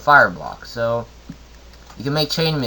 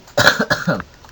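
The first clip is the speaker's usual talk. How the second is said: short unvoiced cough; a real dry cough; 4 dB louder than usual. Real, not acted. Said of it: "I'm coughing way too much".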